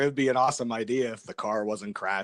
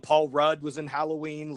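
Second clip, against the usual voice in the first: normal talking voice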